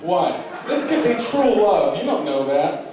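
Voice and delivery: loud, high-pitched mock outrage; mock indignant protest. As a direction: protest like a spurned suitor. Mock wooing a refusing audience member.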